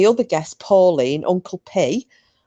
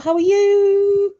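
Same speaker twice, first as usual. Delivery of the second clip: much higher, slow drawn-out how are you; sing-song greeting; 3 dB louder, much slower than usual. Real, not acted. Host greeting Sam arriving in the chat, sing-song drawn-out.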